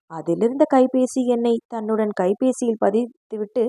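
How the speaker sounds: plain reading voice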